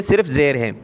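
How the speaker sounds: usual speaking voice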